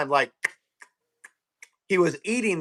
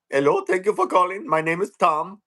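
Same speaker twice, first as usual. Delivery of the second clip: put-on Indian accent. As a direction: mimic an Indian support agent's accent. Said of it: "their call centers are all in India now".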